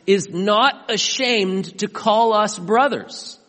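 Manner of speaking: loud, slow, high proclamation; preached loud. Acted. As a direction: drive the promise home at full voice. Key line preached loud.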